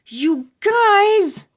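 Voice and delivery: high, loud half-laughing protest; exasperated cry. A narrator's reading. Judy scolding Stink and Webster, loud and high. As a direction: complain loudly at the pranksters.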